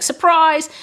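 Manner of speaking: high, loud "Surprise!"; spring it out like a jump scare; mock surprise shout. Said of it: "and just be like".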